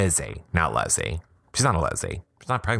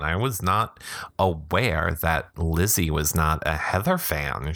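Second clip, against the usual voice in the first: ordinary talk, nothing marked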